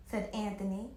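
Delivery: usual speaking voice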